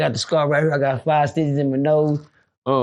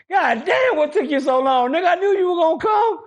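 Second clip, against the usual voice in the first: excited shout; 11 semitones higher than usual. Retold or imitated. To friend Kenna Wayne arriving, a high excited shout. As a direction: shout with relief at the rescuer.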